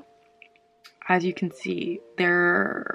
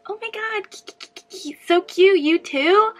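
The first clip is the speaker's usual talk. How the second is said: high, loud mock-sweet gushing; high squealing fake friendliness, much louder; 11 semitones higher, 7 dB louder than usual. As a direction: gush like a fake-nice girl. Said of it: "they try to make it all like".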